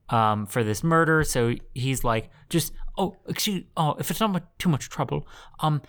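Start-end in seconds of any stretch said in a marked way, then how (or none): 2.5-5.8 hammed-up hesitant politeness; timid polite mobster. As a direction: plead meekly, fading almost to a whisper. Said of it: "he has to pretend to be a mobster".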